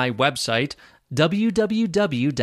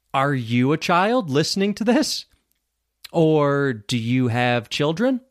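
normal talking voice

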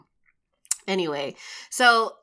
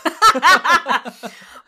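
loud laugh; 9 semitones higher, 12 dB louder than usual. Real, not acted. Laughs at Hero pun.

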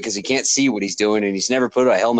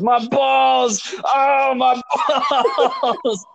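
much higher mock yell breaking into laughter; mocking shout; 11 semitones higher, much slower than usual. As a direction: imitate brother crying out, cracking up. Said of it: "all I'm hearing Shane say is".

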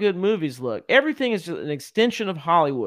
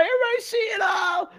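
much higher, strained mock shout; mock crowd outcry. Podcaster imitating people spotting a sky projection, high mock outcry. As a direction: put on an excited bystander's cry. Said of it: "they'll be like".

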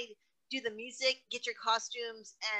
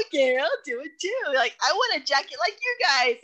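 louder, eager little mock voice of herself joining in; eager tagalong; 4 semitones higher, 8 dB louder than usual. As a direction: play it like the kid who wants in too. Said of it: "I'm like".

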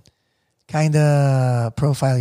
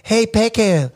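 put-on higher-pitched Eagle Rock Filipino accent; 6 semitones higher than usual. Imitating fresh-off-the-boat Filipinos at the plaza, Eagle Rock accent. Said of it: "because I would hear them".

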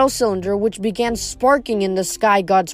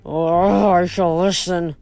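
lower, grudging concession; grudging grumble; 4 semitones lower, slower than usual. A narrator's reading. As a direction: give in with a grumble. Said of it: "Zeus grumbled some more".

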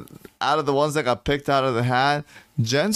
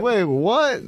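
surprised; 9 semitones higher than usual. Retold or imitated. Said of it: "They're like".

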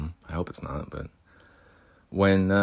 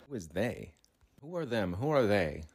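muttered mockery; 2 semitones higher, 9 dB quieter than usual. Mocking police question.

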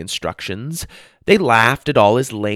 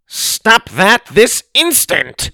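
much higher, louder, slow shouted order; commanding shout; 11 semitones higher, 7 dB louder, much slower than usual. A narrator's reading. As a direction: bellow it at the rioting robots. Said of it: "Augustus shouted".

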